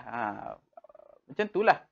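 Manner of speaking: ordinary talk, nothing marked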